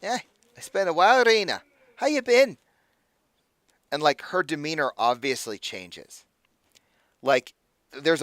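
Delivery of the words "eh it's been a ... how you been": higher, louder easygoing greeting; casual dwarf Brutus. Acted. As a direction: greet an old flame with a grin. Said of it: "and he says".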